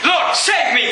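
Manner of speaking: high shouted plea; desperate mock shout for help; crying out. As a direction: shout for rescue. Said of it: "he cried out".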